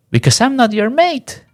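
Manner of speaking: put-on very high, indignant child's voice; offended kid. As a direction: squeak it out like a kid who's been insulted. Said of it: "And I was like".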